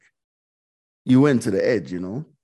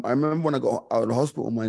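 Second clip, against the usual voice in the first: ordinary talk, nothing marked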